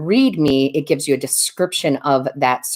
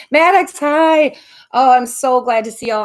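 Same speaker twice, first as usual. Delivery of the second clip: excited greeting; 8 semitones higher, 5 dB louder than usual. Real, not acted. Greeting viewers joining the live.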